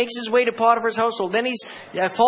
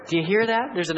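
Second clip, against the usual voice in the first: ordinary talk, nothing marked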